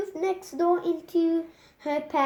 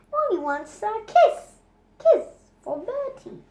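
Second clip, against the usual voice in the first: put-on high sing-song baby talk, louder; cooing to a toddler; 8 semitones higher, 6 dB louder than usual. Mother cooing at Molly to kiss Bertie, high baby talk. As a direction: coo it at the little girl.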